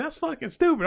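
high, softened imitation of a child; cussing kid. Little cousin cussing freely, imitated high, soft child voice. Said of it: "He was like".

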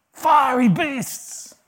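high, loud and slow; hammed-up terror at the fiery beasts; mock dramatic. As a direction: overblown exclamation, slowed right down. Exaggerating difficulties, mock dramatic.

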